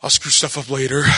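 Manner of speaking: low, weary dog voice; lightly voiced tired pet; tired devil. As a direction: sigh it out like a worn-out little devil. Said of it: "he's like a tired devil".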